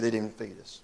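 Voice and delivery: soft, low-key repeat; hushed, grave retelling. Retold or imitated. Preacher repeating Carl's hunger as a prisoner, hushed and grave. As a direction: let it land quietly. Repeats veteran's grim detail, quietly.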